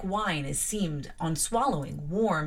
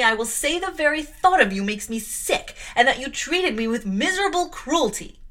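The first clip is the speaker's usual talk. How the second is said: loud, high, trembling defiance at her aunt; furious outburst; 4 semitones higher, 6 dB louder than usual. Acted. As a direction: shout it at her, shaking with rage. Jane shouting at Mrs Reed.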